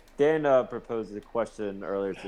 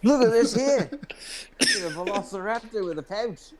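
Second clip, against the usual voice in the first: high, hammed-up wonder; amazed onlooker; 12 semitones higher than usual. Guy marvelling at the kangaroo, high mock amazement. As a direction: gawk at the beast, voice pitched way up. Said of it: "He's like".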